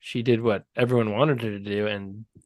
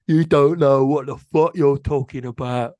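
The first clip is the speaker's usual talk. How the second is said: put-on louder British gangster accent; British thug; 6 dB louder than usual. Host imitates a British gangster from a Guy Ritchie movie. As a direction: menace it like a Guy Ritchie heavy. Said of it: "British accent of the thug guys".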